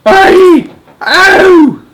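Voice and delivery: very high, loud yelps; roared cries of pain. Acted. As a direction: howl as if under attack. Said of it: "roared the flesh lump eater".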